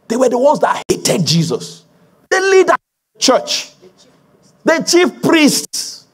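high-pitched, insistent sermon delivery; impassioned preaching. Real, not acted. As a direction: drive each repeated charge home to the congregation. Preaches with rising fervour.

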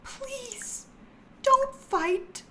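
put-on high, soft pleading girl voice; pleading character voice. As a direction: plead softly like a meek sim. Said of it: "She's like".

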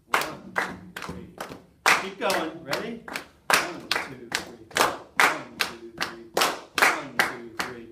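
loud counting over the class clapping; leading the class in syncopated clapping; shouted count over clapping. Shown as a demonstration. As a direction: call the beat over the noise. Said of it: "very strong accent on the four".

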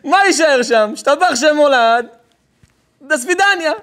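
loud, high, hammed-up gloating; gleeful scheming. Kidnapper gloating to his partner, loud and gleeful. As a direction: crow it out with a laugh in it.

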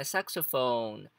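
slow saxophone, stressed wrongly on the last syllable PHONE; stress on final PHONE. Shown as a demonstration. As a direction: drag out PHONE at the end of saxophone. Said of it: "se falar assim fica errado". (saxophone com a tônica errada no phone final)

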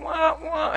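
high-pitched "wah, wah" sound effect; imitated camera noise. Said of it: "make a noise like".

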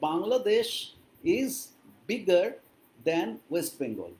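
slow, spaced-out English; slow model sentence. Shown as a demonstration. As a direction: pause after each word or two. Teacher models the answer slowly. (ধীরে ধীরে, থেমে থেমে ইংরেজি)

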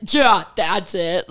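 slow, drawn-out sarcastic yeah; retold sarcasm, dragging out the words. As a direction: pretend to agree, slowly and sarcastically. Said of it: "Like, to be sarcastic".

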